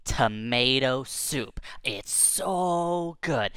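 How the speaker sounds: slow, whispery wonder; hushed reveal. Acted. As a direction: draw it out in an awed whisper. Dramatic reveal of the soup.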